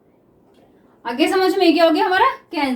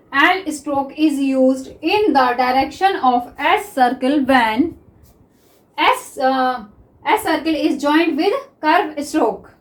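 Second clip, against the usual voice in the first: English rule read very slowly word by word; teacher dictating the rule to students; slow dictation; much slower than usual. Shown as a demonstration. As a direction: spell out the rule as you write it. Reading rule while writing slowly.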